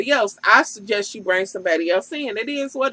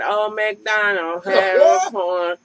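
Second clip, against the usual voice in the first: mock off-key performance; 4 semitones lower, much slower than usual. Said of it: "it sounded like she was saying".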